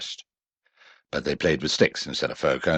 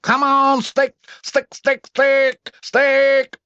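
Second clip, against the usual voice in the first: very high, loud repeated cheer; calling out; 6 dB louder, much slower than usual. A narrator's reading. Roo wriggling and cheering on his stick, loud call. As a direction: urge the stick on like a fan. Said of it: "calling out".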